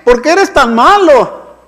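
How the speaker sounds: acted angry yell. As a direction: hurl accusing questions, imitating an outraged mourner. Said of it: "empiezan a gritarle a Dios". (grito de reproche a Dios, imitado)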